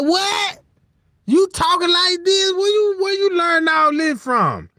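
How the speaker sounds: put-on family stunned that the baby talks, high shocked shout.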